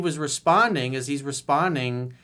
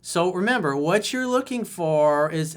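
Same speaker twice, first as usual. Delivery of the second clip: ordinary talk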